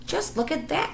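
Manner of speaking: high, excited little cry. Acted. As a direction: Smudger spotting a huge animal, crying out high and excited. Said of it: "cried Smudger".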